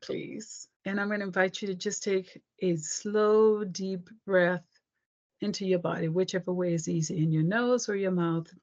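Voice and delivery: guided meditation. Acted. Leads a guided breathing meditation.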